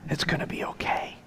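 hushed, unvoiced-breath whisper into an ear; very soft soothing whisper, with a long pause between; comforting whisper. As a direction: whisper it softly, as reassurance to a dying dog. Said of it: "I just was whispering in her ear".

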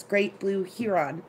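"heron" said slowly as HEE-ron; drawn-out "heron" with long ee, HEE-ron; childhood HEE-ron pronunciation. Shown as a demonstration. Recalling the words from growing up, "heron" as HEE-ron. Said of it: "were called herons".

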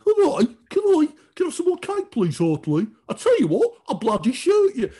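high, loud Brummie caricature; hammed-up Brummie villain voice, pitched high; Brummie accent. Co-host mocking the idea of a Brummie mastermind, Brummie accent. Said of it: "you can't have a Brummie mastermind".

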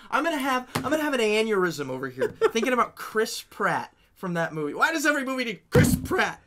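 frustrated outburst. Real, not acted. Podcaster fed up with a disappointing movie, frustrated outburst. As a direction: voice jumping up in comic exasperation. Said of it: "you're angry. Like, you're, you're, you're frustrated with the movie".

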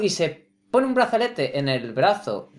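ordinary delivery

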